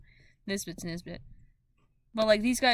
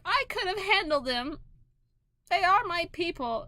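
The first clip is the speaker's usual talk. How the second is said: higher, offended little penguin; Nisbet voiced; 9 semitones higher than usual. Nisbet to Imbros, a higher offended voice. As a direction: insist you could have handled them. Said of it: "he's just like".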